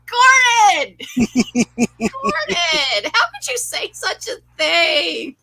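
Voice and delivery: high-pitched, laughing reproach; hammed-up shocked cry, half laughing; mock scandalised. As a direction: scold him in exaggerated dismay. Mock scolding a character in disbelief.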